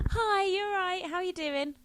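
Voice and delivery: high, loud, bright cheery hello; sing-song friendly hello to the driver; chirpy greeting. Retold or imitated. Greeting the silent bus driver, high cheery mock hello. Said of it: "get onto the bus".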